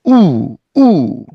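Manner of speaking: 우 said apart, loud; lone oo; isolated oo. Shown as a demonstration. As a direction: stress 우 as a lone vowel. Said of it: "우를 따로 발음하죠".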